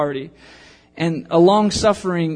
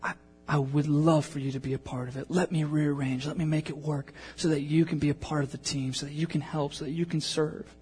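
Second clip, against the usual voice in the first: gentle apology; 8 dB quieter than usual. Retold or imitated. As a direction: comfort a crying boy quietly. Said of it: "But he said".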